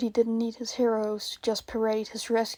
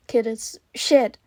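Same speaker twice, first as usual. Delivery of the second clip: slow, louder curse; 9 dB louder, slower than usual. Acted. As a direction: swear it out loud. Cursing at the scene.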